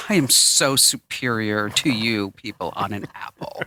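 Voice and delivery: haughty put-on voice; mock superiority. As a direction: look down on them, slowly and grandly. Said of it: "you'd be like".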